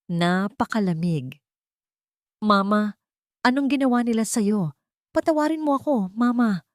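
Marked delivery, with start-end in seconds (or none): 2.4-6.6 pleading, pitched higher and rising; son's tearful apology, mildly sobbing; crying. Acted. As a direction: beg her forgiveness, close to tears. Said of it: "Umiiyak na".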